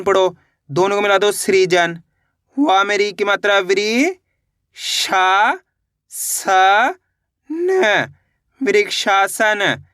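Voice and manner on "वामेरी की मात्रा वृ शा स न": very slow, each syllable drawn apart. Shown as a demonstration. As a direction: spell it out slowly, piece by piece. (अक्षर-अक्षर धीरे-धीरे)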